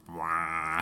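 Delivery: long droning "waaah" like a film-score horn; imitated score blare. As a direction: mimic a big held brass blast. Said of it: "doesn't really have themes, it just has".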